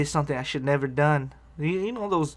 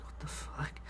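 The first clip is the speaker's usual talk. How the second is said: whispered imitation of a bartender's disbelief; mock disgusted reaction; 17 dB quieter, slower than usual. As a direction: mouth it in a barely-there whisper, appalled. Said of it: "she makes a face like".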